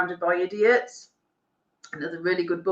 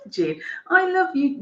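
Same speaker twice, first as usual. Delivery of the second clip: tearful; 10 semitones higher, slower than usual. Real, not acted. Moved by a viewer's kind message.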